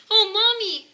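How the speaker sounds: high, loud cry for Mommy; distressed cry. Acted. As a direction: call out in alarm for her mother. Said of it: "she cried".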